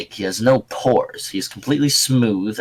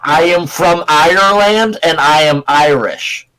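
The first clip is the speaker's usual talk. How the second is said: loud, higher-pitched mock Irish accent; 5 semitones higher, 5 dB louder than usual. Shown as a demonstration. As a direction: proclaim it in a put-on Irish accent. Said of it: "This is my Irish accent".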